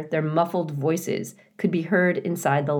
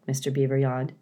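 ordinary delivery, nothing marked

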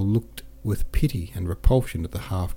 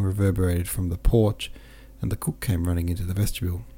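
usual speaking voice

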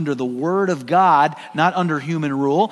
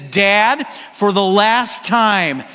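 slow, loud, over-enunciated correction; exasperated shout; 4 semitones higher, 4 dB louder, much slower than usual. Retold or imitated. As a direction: spell it out for the hundredth time. Exasperated son corrects father.

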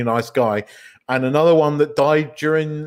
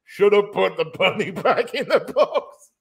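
higher, giggling one-liner; laughing through the quote; 8 semitones higher than usual. Retold or imitated. Host quoting the Bond-style quip, laughing through it. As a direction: try to deliver it but crack up. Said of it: "he goes".

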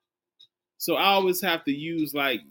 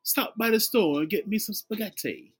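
high, lilting request; put-on dictation voice; dictating a shopping text, lightly mock; 7 semitones higher than usual.

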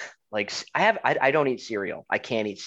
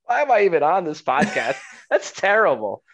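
louder, laughing complaint; laughing exasperation; 6 semitones higher, 5 dB louder than usual. Real, not acted. Cohost laughing at the dry cereal habit, mock fed up. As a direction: groan it through laughter.

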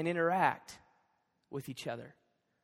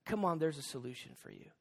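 soft, lower, soothing aside; gentle reassurance; 5 semitones lower, 7 dB quieter than usual. Acted. Jesus leaning in after our mistake, reassuring. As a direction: lean in and comfort quietly. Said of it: "he kinda leans in and goes".

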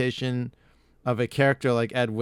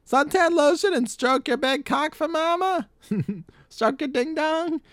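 lunch lady voice. Retold or imitated. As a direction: coo like a creepy doting mom. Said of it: "my lunch lady's kinda based on Adam Sandler's".

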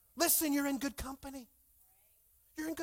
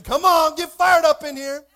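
very loud, raised-pitch rally cry; rousing shout; 5 semitones higher, 17 dB louder than usual. Real, not acted. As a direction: fire up the whole room. Rallies a quiet congregation.

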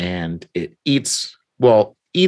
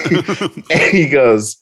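laughing; 6 semitones higher, 6 dB louder, much slower than usual. Real, not acted. Laughing through the setup.

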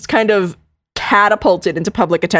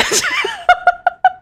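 high laugh. Real, not acted. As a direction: burst of high giggling. Laughs after getting to talk.

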